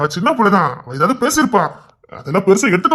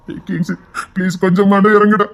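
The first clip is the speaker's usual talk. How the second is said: usual speaking voice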